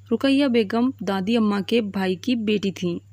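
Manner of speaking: ordinary delivery, nothing marked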